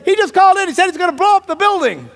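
high, loud police alarm; hammed-up urgent report; urgent mock shouting. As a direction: call out the emergency in a hurry.

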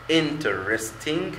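"interesting" very slow; syllables drawn out. Shown as a demonstration. Teacher counting syllables aloud to students. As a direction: stretch out "interesting" beat by beat. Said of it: "أدي مقطعين".

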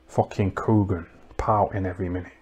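ordinary delivery

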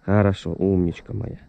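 ordinary talk, nothing marked

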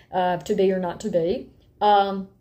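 ordinary talk

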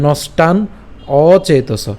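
"নষ্টান", pause, then "অচেতস"; split pronunciation. Shown as a demonstration. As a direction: separate নষ্টান from an initial অ in অচেতস. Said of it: "এটা উচ্চারণ করতে পারেন". ("নষ্টান অচেতস" ভেঙে উচ্চারণ)

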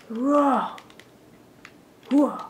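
high loud roar then hooah; loud gung-ho soldier. Acted. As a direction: yell like a charging soldier. Attacking an enemy.

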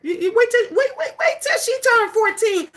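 high, excited warning voice; a hammed-up mom getting ahead of it; mock panicked mother. Mom warning the crowd in a high, excited voice.

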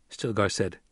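ordinary delivery, nothing marked

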